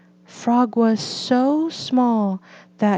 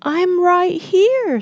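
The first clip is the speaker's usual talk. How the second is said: Frog reassuring; 10 semitones higher, 3 dB louder than usual. A narrator's reading. Frog answering.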